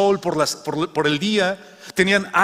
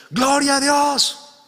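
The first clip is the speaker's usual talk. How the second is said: joyful shout; 11 semitones higher, 3 dB louder, much slower than usual. Acted. Old woman shouting praise again.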